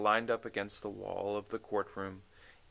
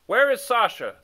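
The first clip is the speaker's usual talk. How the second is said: loud, raised royal question; king asking; 10 semitones higher, 11 dB louder than usual. Acted. The king asks after Sasha, loud. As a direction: demand it from the throne.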